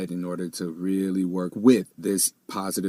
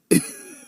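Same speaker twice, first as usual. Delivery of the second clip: high burst of laughter; 6 dB louder than usual. Real, not acted. Laughing at own reaction.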